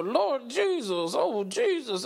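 slow, drawn-out churchy moaning; mock praising Jesus. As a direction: savour each word like a mock church praise. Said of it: "except for".

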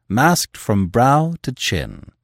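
plain reading voice

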